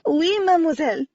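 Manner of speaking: shivery French woman; shivering French accent. A narrator's reading. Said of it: "she said on another shiver".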